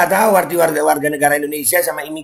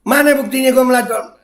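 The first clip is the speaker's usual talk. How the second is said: angry shout; 7 semitones higher than usual. Real, not acted. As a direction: yell back at the accuser to report him. Shouting back at accuser. (teriakan marah, jauh lebih tinggi dan keras)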